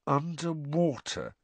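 slow, thoughtful delivery; slowly. A narrator's reading. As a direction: say it slowly, working it out. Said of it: "Harry said slowly".

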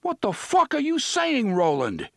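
loud, high furious roar. A narrator's reading. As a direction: bellow it in outrage. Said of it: "Eddie roared".

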